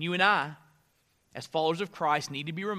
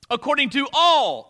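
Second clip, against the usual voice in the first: raised voice landing hard on "all"; stress on "all"; 6 semitones higher, 7 dB louder than usual. Shown as a demonstration. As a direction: punch out the word "all". Verse read, "all" to be underlined.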